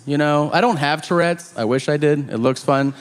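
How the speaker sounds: ordinary talk, nothing marked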